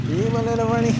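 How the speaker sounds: high sing-song greeting to an animal; pet talk. Real, not acted. Talking to a little animal friend.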